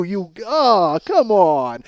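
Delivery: ordinary delivery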